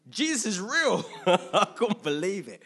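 loud, high, laughing through it; overjoyed disbelief, laughing; loud laughing exclaim; laughing joy. Retold or imitated. Reliving own conversion joy.

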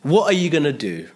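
ordinary delivery

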